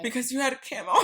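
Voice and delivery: high, loud, quick laughing guess; laughing realization. Real, not acted. Friend guesses the camo mishap, laughing. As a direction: burst in with the punchline, cracking up.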